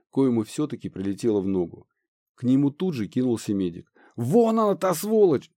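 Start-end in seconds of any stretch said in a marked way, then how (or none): 4.2-5.5 high loud spotting shout; angry shout. Acted. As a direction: yell out, pointing at the enemy. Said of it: "закричал один из бойцов".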